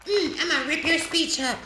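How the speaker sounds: a hint of a high, snippy Pelosi; imitating Pelosi. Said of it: "Nancy Pelosi and her like".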